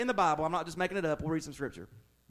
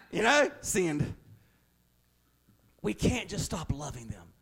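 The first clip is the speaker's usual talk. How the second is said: usual speaking voice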